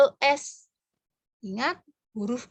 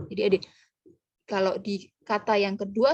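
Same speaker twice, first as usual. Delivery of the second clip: ordinary talk, nothing marked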